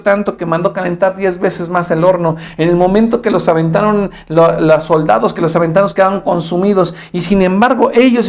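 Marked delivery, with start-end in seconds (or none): none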